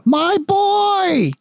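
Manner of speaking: very high joyful cry; overjoyed father. Acted. Father welcoming his son home, very high and overjoyed. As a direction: burst out with joy.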